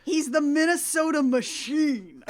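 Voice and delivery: slow, booming hype-man intro; sports announcer voice. Pretend. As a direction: announce him like an arena speaker. Hyping Doug like an announcer.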